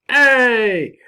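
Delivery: loud, high little cheer; excited cheer. Real, not acted. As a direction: celebrate the score out loud. Cheering an eighty percent score.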